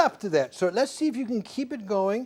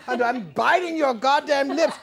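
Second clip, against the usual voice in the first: loud, higher, breaking into laughter; mock fierce outburst; 7 semitones higher, 7 dB louder than usual. Shown as a demonstration. Coach acting out the biting line to the singer, mock fierce. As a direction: belt it out through a laugh.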